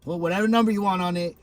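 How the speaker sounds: ordinary talk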